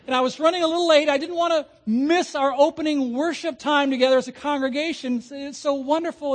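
high-pitched, mock-innocent excuse-making; apologetic driver. Driver on the way to church excusing speeding to an officer, high-pitched and apologetic.

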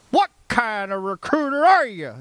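hammed-up indignant Southern boss; Southern boss berating. Boss publicly berating his recruiter, imitated Southern accent. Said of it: "he would say that".

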